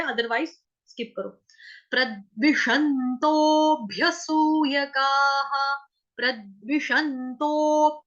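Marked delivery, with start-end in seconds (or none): 1.9-8.0 प्रद्विषन्तोभ्यसूयकाः slowly, a light half ब; slow recitation. Shown as a demonstration. Teacher showing the half ब, slowly. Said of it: "आधा बकरी वाला ब बोला है".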